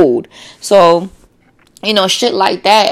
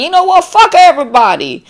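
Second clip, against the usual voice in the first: higher, louder mock blow-up; fed-up outburst; 12 semitones higher, 4 dB louder than usual. Tired person in traffic, mock fed-up outburst. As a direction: toss it off like you're done with everyone. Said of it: "you're like".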